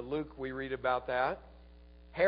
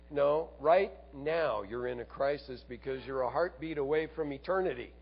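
ordinary talk, nothing marked

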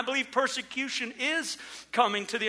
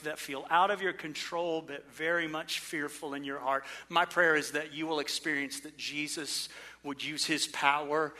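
gentle prayer; 5 semitones lower, 4 dB quieter than usual. Acted. Soft prayer for the congregation.

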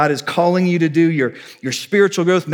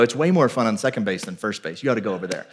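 lower, softer confiding aside; low-key coaxing; 7 semitones lower, 6 dB quieter, faster than usual. Retold or imitated. Coach persuading kid that second base is more fun, confiding. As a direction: coax casually, dropping pitch and volume.

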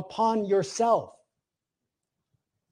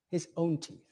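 soft repeat; 3 semitones lower, 11 dB quieter than usual. Shown as a demonstration. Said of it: "So the middle voice would be".

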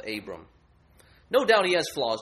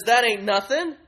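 much higher and louder, lofty idol; grades idol; 9 semitones higher, 8 dB louder than usual. Acted. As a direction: sneer like a lofty scholar. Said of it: "He says".

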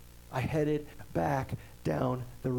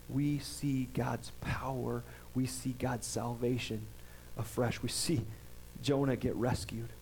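hushed emphasis; 5 semitones lower, 6 dB quieter than usual. Real, not acted. Preacher drops voice for emphasis.

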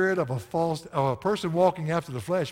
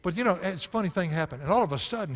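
ordinary talk, nothing marked

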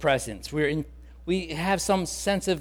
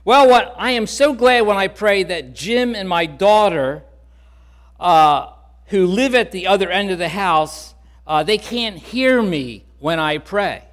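lightly voiced old woman, higher and louder; a hint of a delighted elderly lady; old lady Emma; 4 semitones higher, 6 dB louder than usual. Emma explains, voiced in story.

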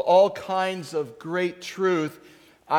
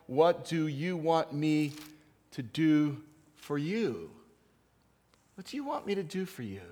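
gentle question; 4 dB quieter than usual. Acted. Jesus asks gently.

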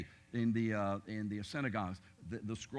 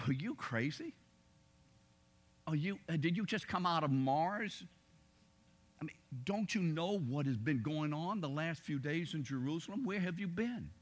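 higher-pitched mock disbelief; imitated incredulity, pitched up; incredulous retort; 5 semitones higher than usual. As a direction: act out a baffled disciple's questioning. Disciples' incredulous retort to Jesus.

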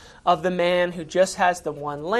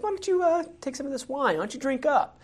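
much higher, wheedling king pushing wine; hammed-up smooth host urging another drink; coaxing king; 9 semitones higher than usual. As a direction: sweet-talk him into drinking. David plies Uriah with wine.

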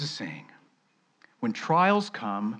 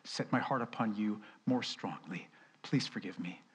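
softer, hushed plea for forgiveness; confessing prayer; 3 semitones lower, 8 dB quieter than usual. Pretend. Preacher voicing a quiet confession to God. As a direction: confess gently, almost a whisper. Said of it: "and say".

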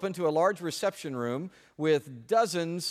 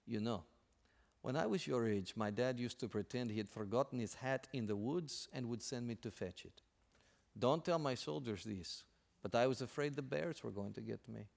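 softer, hushed confiding; whispered secret; 4 semitones lower, 9 dB quieter than usual. Acted. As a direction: tell it like a secret nobody may hear. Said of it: "In a whispered voice".

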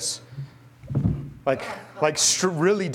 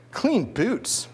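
mocking scorn; 8 semitones higher than usual.

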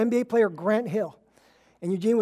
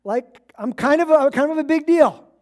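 louder, higher, playfully smug self-introduction; cocky Gabriel; 5 semitones higher, 6 dB louder than usual. Retold or imitated. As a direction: brag like you know you're important. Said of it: "Gabriel here is like".

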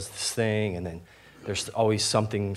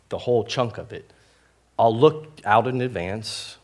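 ordinary delivery

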